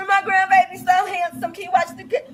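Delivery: high, gushing grandma with laughing sobs; mock gushing grandma. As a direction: gush over the grandbaby, half crying.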